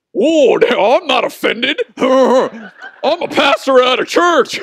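slow, high, loud teasing through laughter; mocking, laughing. Daughter mocks her offended pastor dad, laughing loud. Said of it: "she starts making fun of me".

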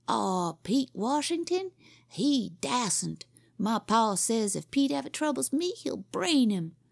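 put-on a kid bragging about his pa; boasting boy.

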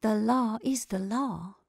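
slow, deliberate, each word set down; firm and measured. A narrator's reading. Sheriff's wife reminding her neighbour of duty, slow and firm. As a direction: state the rule calmly and slowly. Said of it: "said the sheriff's wife".

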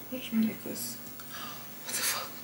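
very soft, near-voiceless muttering to self; hushed, alarmed aside to yourself; startled whisper. Real, not acted. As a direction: whisper it under your breath, baffled. Startled mid-sentence by something near her.

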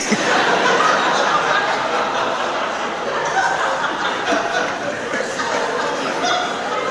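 long breathless laugh pitched very high; silent laugh. Real, not acted. Priest laughing hard at his own joke to the congregation, a long silent wheeze.

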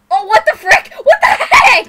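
laughing shout. Real, not acted. Startled by his swing, a loud laughing shout. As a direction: yell it through laughter, startled.

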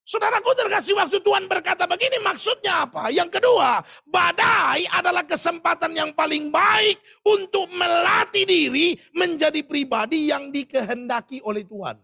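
loud, high preacher's shout; full-voiced sermon climax; preaching at full force. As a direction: thunder it at the congregation. Preaching loudly.

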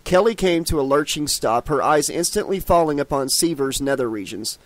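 ordinary delivery

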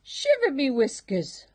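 high, loud cartoon pirate cat; a hammed-up pirate exclamation; salty first mate. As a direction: bellow it like an old sea dog. First mate voiced in story.

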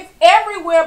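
ordinary talk

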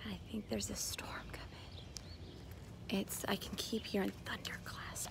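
soft morning whisper to the camera. Real, not acted. Mom whispering at dawn to the camera, soft whisper. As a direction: breathe it, no voice. Quiet early morning filming.

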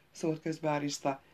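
ordinary delivery

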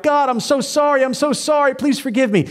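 imagined sinner pleading sorry, pitched high; mock crying out. Preacher voicing a sinner's imagined plea, high and pleading. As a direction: beg forgiveness in a high, pleading voice. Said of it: "they're crying out".

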